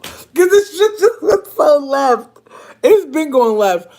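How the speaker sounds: high, loud, slow squeal; overcome, high-pitched exclaiming; cracking up. Real, not acted. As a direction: squeal it out through laughter. Cracking up while saying goodbye.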